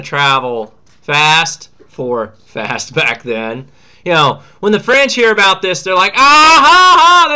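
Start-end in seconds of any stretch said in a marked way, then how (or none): 6.1-7.3 high, loud mocking laughter. The French laughing at the surrendered British. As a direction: cackle at the British defeat. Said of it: "They think this is hilarious".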